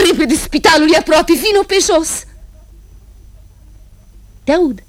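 high, urgent plea; urgent call. Acted. Woman urging her sister to come quickly. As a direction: hurry her along.

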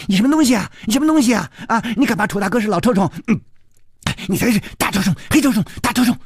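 high-pitched indignant cursing; cheeky youngster's furious tirade; loud scolding. A narrator's reading. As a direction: hurl insults fast and high. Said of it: "小秃子儿大声骂着说".

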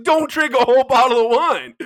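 loud, high exasperated yell; mock scolding shout. Friend mocking his wine complaint, scolding shout. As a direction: holler it like an obvious scolding. Acted scolding reply to himself.

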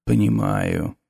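low, soft, dark necromancer voice; sinister professor. A narrator's reading. Necromancer introducing himself to the nervous teacher, low and soft. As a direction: speak low and soft with quiet menace.

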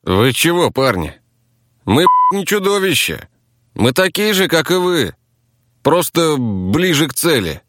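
high-pitched, aggrieved self-defence; indignant taxi driver. Acted. Taxi driver justifies his team to rivals, indignant.

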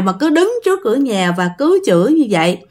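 ordinary delivery, nothing marked

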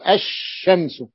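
الشمس read slowly with the lam merged into a doubled shin; الشمس drawn out with the doubled sh sound; slow recited example. Shown as a demonstration. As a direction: stretch out الشمس as "ash-shams", the L silent. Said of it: "التي لا تنطق".

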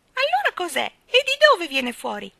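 shrill, loud piping questions; high-pitched fledgling. A narrator's reading. Young bird pesters with questions, high-pitched piping. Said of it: "domandò con voce acuta".

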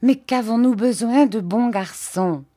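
high, petulant pout. A narrator's reading. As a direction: object with a little pout. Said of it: "dit-elle avec une petite moue".

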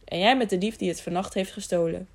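ordinary delivery, nothing marked

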